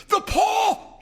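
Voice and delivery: high, loud, sudden cry; wild cry. A narrator's reading. Wife waking her husband with a wild, high cry. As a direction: cry it out wildly in the night. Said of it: "she cried wildly".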